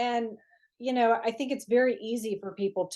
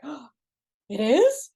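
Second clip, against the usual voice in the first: mock surprise; 3 semitones higher, 6 dB louder than usual. Gasping realization, laughing.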